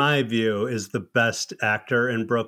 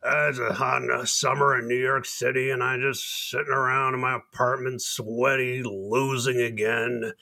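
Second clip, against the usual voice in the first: imitating record-store guy; 2 semitones lower, 3 dB quieter than usual. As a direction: imitate a sweaty loser moaning about his lot. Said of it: "he would do this imitation".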